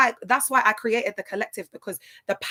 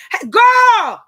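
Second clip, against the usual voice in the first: sudden high, loud shout; big high-pitched greeting yell; calling out; 12 semitones higher, 12 dB louder than usual. Real, not acted. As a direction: holler excitedly to a friend. Calling out to a friend.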